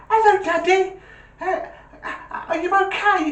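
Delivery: put-on very high, eager small child; high-pitched kid greeting his dad; little voice. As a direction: put on a little boy's phone voice. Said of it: "this little voice goes".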